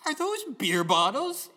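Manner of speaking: re-enacted scandalized question; incredulous question. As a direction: stretch it out, appalled at the find. Retold confrontation over beer bottles.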